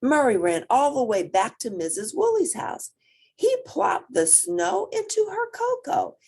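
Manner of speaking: plain reading voice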